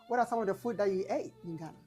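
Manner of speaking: ordinary talk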